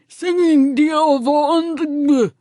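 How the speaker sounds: high, slow, lips-still ventriloquist voice. Acted. Narrator does ventriloquism at shoppers, high and slow. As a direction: throw the words like a ventriloquist dummy. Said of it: "in my best ventriloquist voice".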